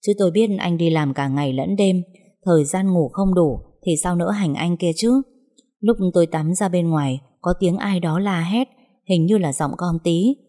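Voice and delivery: ordinary delivery, nothing marked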